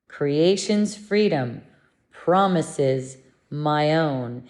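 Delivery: slow, deliberate affirmation; measured lesson line, drawn out; slow recitation. Real, not acted. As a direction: recite it slowly, phrase by phrase. Repeats the lesson affirmation for practice.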